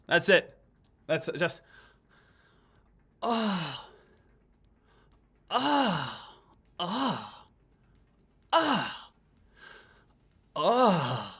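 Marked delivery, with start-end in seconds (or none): 3.2-11.3 long heavy breathy "oh" exhale; heavy breathing. Shown as a demonstration. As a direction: breathe out hard on a drawn-out "oh". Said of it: "breathe heavy for a little bit".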